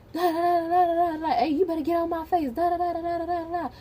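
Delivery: high-pitched, rattled-off bickering gibberish; mock arguing back. Student retelling how they talked back to screaming girls, mock bickering. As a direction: imitate yourself yapping back in a squabble. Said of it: "they're screaming, and I'm saying stuff back".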